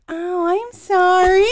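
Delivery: high, slow, loud mock apology; sheepish sister.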